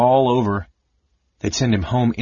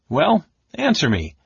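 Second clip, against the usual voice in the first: high, insistent mage's demand; impatient interrogator with raised pitch; 7 semitones higher than usual. A narrator's reading. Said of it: "the red-robed mage demanded".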